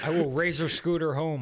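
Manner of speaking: hammed-up stubborn threat; mock threat. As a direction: join in with a playful defiant declaration. Friend joins in the mock threats.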